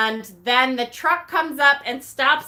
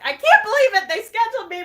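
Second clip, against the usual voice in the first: disbelief; 9 semitones higher, 3 dB louder, faster than usual. Retold or imitated. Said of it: "I'm like".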